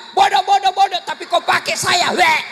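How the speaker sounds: high, loud mocking retort; wife talking back. Wife retorts to insulting husband. (istri membalas suami yang memaki, tinggi dan keras)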